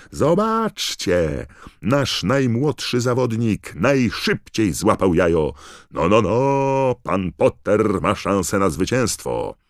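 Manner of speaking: high, loud, drawn-out commentator shouting; Bagman yelling. A narrator's reading. Commentator hailing the youngest champion to the crowd, excited yelling. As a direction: shout it slowly, stretching the words in excitement. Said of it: "wrzeszczał Bagman".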